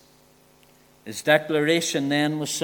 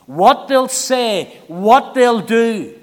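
very slow, every word weighted; preacher naming the crowd's pull slowly; slow emphatic; 4 semitones higher, 7 dB louder, much slower than usual. Acted. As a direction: spell out the fear word by word.

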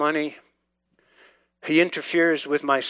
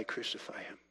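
whispered cry; 7 semitones lower, 19 dB quieter than usual. Retold or imitated. Preacher voicing the heart's hidden cry, whispered. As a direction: whisper it, much softer.

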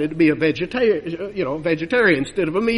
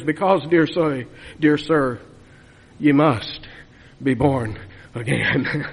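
Whitefield's reply; 6 semitones lower, slower than usual. Retold or imitated. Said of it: "Whitefield looked at him and says".

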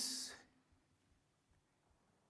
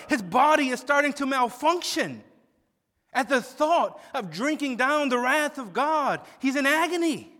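louder, higher, impassioned preaching; preaching at full force; 6 semitones higher, 7 dB louder than usual. Acted. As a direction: drive the point home from the pulpit.

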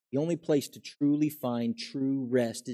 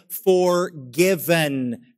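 drawn-out word; 4 semitones higher, 7 dB louder, much slower than usual. Acted. Drawn-out declaration of forgiveness.

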